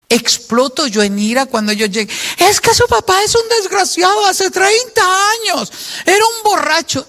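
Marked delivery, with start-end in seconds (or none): 2.4-7.1 much higher, scornful voice of a resentful spouse; bitter badmouthing parent. Resentful parent running down the father to the children, high and scornful. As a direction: spit out contempt for the father to the kids.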